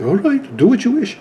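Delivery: put-on botched British accent, pitched high; high, wobbly attempt at a British accent; muddled accent. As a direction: imitate a British teacher and miss the accent. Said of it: "I don't know what accent that was".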